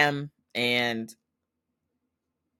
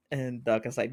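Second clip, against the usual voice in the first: ordinary talk, nothing marked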